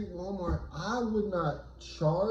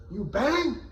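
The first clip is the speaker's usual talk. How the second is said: gangbanger challenge; 8 semitones higher, 3 dB louder than usual. Retold or imitated.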